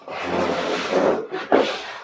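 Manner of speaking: long, heavy sigh of a tired husband; weary sigh. Retold or imitated. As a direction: let out a drained sigh. Husband home exhausted.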